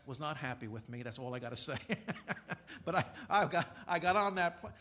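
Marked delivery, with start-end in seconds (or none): 1.8-2.8 real chuckle at his own story. Real, not acted. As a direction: laugh briefly, amused at yourself. Laughs at his own anecdote.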